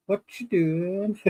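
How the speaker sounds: drawn-out playful imitation of Jack peeking in; quoting Jack. S0 quoting Jack's line to the podcast hosts, slow and teasing. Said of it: "It's like".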